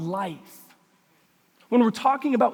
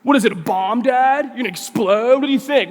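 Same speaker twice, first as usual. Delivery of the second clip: louder, higher incredulous mockery; scoffing teen; 4 semitones higher, 9 dB louder than usual. Teenage son scoffing at dad's warning, acted loud sarcasm. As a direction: throw dad's warning back at him.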